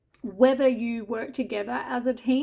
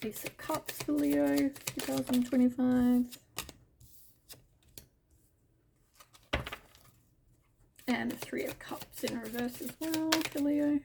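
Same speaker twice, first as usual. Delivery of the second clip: hushed tarot asking; 6 dB quieter than usual. Real, not acted. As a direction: murmur each card's name to the deck. Asking the cards in a hush.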